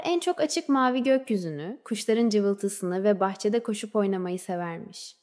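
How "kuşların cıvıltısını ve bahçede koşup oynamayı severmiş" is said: plain reading voice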